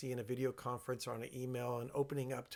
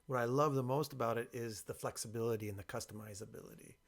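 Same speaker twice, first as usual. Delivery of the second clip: ordinary talk, nothing marked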